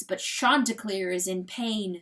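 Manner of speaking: plain reading voice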